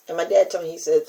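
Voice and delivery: usual speaking voice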